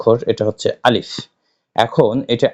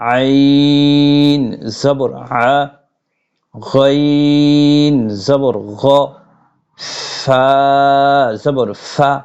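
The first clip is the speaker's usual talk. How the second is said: slow Arabic letter drill; 2 semitones higher, much slower than usual. Shown as a demonstration. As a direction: recite each letter slowly like a Quran teacher. Leads a recitation drill. (ধীরে টেনে টেনে আরবি হরফ পাঠ)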